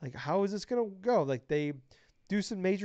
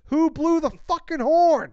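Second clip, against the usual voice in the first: real excited yell, much louder and higher; 10 semitones higher, 10 dB louder, slower than usual. Real, not acted. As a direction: shout it out, thrilled and outraged. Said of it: "I'm yelling".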